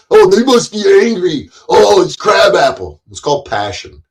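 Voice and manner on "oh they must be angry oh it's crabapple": higher, louder whiny mock voice of people who misjudge him; mocking imitation. Streamer mimicking chat critics, mocking and shrill. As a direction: parody the clueless critic, hammed up. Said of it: "when you see somebody who says it".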